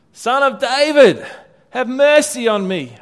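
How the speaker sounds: high, loud, pleading cry. Acted. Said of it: "he only shouted louder".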